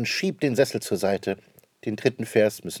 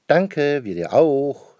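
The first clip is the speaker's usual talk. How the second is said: louder, higher family answer together; cheerful chorus reply; 6 semitones higher, 8 dB louder, much slower than usual. Acted. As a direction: answer all at once, gladly. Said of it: "sagen die anderen". (die Familie antwortet der Mutter fröhlich im Chor)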